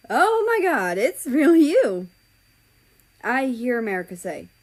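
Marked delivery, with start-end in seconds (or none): 0.1-2.1 high, loud, thrilled greeting; excited. Acted. America greets his best friend China, high and loud with joy. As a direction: burst out with joy at an old friend.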